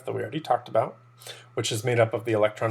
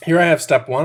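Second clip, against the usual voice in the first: usual speaking voice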